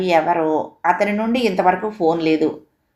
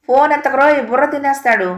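much higher, louder shouted warning; loud ringtone yell; 8 semitones higher, 4 dB louder than usual. A narrator's reading. Ringtone blaring again as the father reaches, loud yell. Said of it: "అంటూ వినిపించింది".

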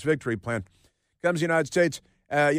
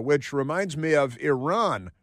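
normal talking voice

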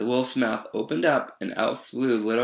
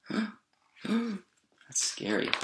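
gasp, then small hushed aside; mock fright; 10 semitones higher, 7 dB quieter, much slower than usual. Reader reacting to the wolf, mock scared. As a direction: shrink back softly, playfully scared.

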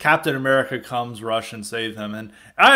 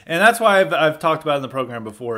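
ordinary talk, nothing marked

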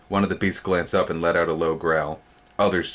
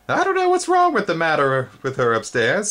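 high, louder gossiping insect voice; fussy insect; 10 semitones higher, 5 dB louder than usual. A narrator's reading. First spindlebug complaining about her upstairs, high fussy voice. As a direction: grumble like a fussy old gardener bug. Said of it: "said the first".

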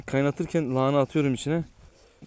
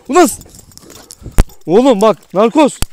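angry shout; 9 semitones higher, 15 dB louder than usual. Real, not acted. Scolding dog Narkoz.

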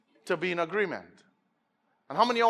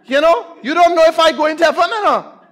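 loud, rising challenge to the congregation; emphatic preacher, voice raised; forceful preaching; 6 semitones higher, 10 dB louder than usual. Real, not acted. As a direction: build to a high pulpit shout.

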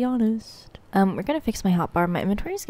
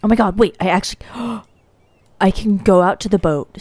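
loud amazed discovery; loud surprised gasp; 6 dB louder, faster than usual. Real, not acted. Player discovering she can reach the boat, amazed and loud. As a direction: blurt it out in disbelief.

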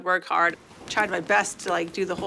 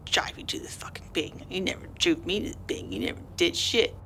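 furious under-the-breath whisper; fuming whisper; 2 semitones lower, 8 dB quieter than usual. Retold or imitated. Fighter cursing her coach under her breath. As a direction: seethe it quietly to yourself. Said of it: "I'm like".

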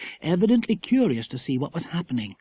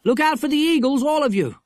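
high, urgent heads-up to friends; excited child's warning; calling a warning; 11 semitones higher, 4 dB louder than usual. Acted. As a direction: alert the group, pitched well up. Jack warns the others.

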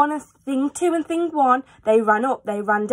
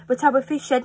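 ordinary delivery, nothing marked

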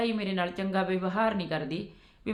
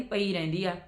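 usual speaking voice

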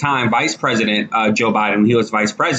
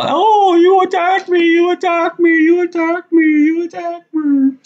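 much higher, repeated whiny mock voice; mocking whiny liberal; 3 dB louder than usual. Mocking liberals who cry victim, high whiny mock voice. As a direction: whine it like a crybaby playing victim. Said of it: "then they go".

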